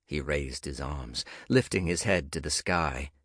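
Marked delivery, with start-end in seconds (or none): none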